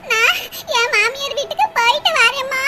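ordinary delivery

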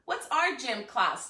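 ordinary delivery

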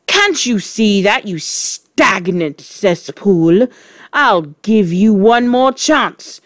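loud, emphatic tirade; furious shouting. A narrator's reading. Trunchbull raging at Wilfred's protest, shouting. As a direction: rant insults and sums at the pupil. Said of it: "shouted the tr-, Trunchbull".

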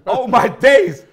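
loud, high burst of disbelief; shocked exclamation. Real, not acted. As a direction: blurt it out, stunned. Shocked at Nasri's size.

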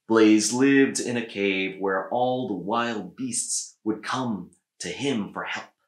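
usual speaking voice

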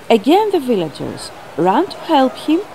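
usual speaking voice

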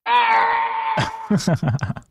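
high squealing laugh; shrieking laugh. Real, not acted. Shrieking laugh at golden dragon.

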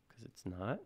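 NOT swooping up into a question; soft, unsure, with "not" rising sharply; questioning rise. Real, not acted. Tutor doubting the fix worked, "not" lifted like a question. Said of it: "Question mark?".

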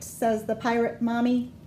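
usual speaking voice

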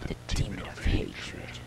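slow, spooky whisper; hushed and drawn out; ominous whisper. Acted. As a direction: whisper the demon's title like a dark secret. Naming the demon in game dialogue.

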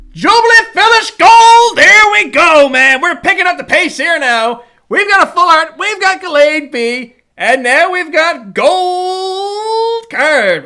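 real thrill at a gold card; excited high voice. Real, not acted. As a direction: voice climbing high and loud with excitement. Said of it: "my voice gets higher and higher".

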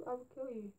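soft, hushed fake threat; hushed pretend menace; mock menacing whisper. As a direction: murmur a joke threat very softly. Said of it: "I'm scary".

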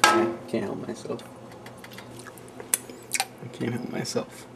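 usual speaking voice

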